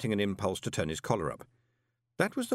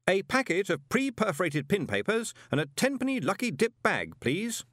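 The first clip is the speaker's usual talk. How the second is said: high, announcing the order for all to hear; loud; 11 semitones higher than usual. A narrator's reading. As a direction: say it loudly so the shop hears. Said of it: "said Moist loudly".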